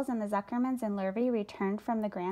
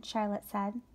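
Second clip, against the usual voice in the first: usual speaking voice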